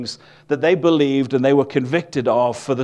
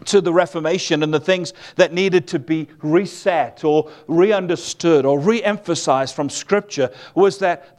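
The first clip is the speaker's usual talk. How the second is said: normal talking voice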